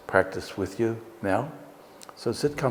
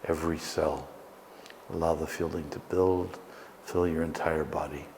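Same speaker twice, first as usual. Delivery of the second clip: guided meditation; 4 dB quieter than usual. Acted. Leads a guided breathing meditation.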